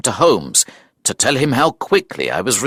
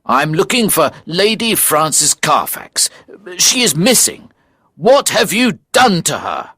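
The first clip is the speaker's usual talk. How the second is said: raised pitch, a touch accusing; mild accusation; 8 semitones higher than usual. Acted. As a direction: press the stranger for an answer, pitched up. Acted accusation of the stranger.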